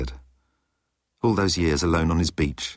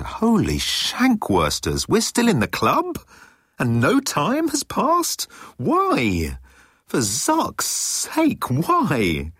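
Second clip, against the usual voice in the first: much higher, rising to an incredulous squawk; shocked disbelief; 11 semitones higher, much slower than usual. A narrator's reading. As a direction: panic climbing as the truth dawns. Ford realising they are trapped.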